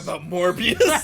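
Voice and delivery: slow, loud laughing mock. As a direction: laugh it out as a joke. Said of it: "mocking Mobius as Morbius".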